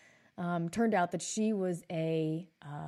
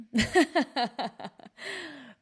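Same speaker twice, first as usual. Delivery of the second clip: loud laugh; 7 semitones higher, 6 dB louder than usual. Real, not acted. Laughs at his own joke.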